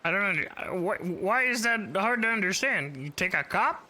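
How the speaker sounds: high voice changer squeak; helium-style chipmunk filter. Real, not acted. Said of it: "chipmunk voice is a little weird".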